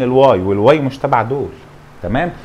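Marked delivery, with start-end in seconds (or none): none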